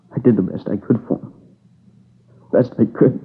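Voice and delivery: choked, tearful voice breaking; crying. Acted. Witness at the grave story, breaking into tears. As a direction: fight back tears and lose. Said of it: "Crying like a kid".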